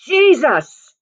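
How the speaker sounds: sudden high, loud cry of the name; breakthrough shout. Retold or imitated. A girl held down by evil cries out the name, loud and high. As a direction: force the name out with all your might. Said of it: "my voice broke through".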